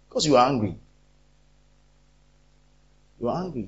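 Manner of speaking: ordinary talk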